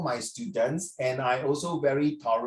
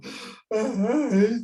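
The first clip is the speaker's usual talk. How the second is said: mock gasp; 5 semitones higher than usual. Real, not acted. Teacher reacts to student yawning.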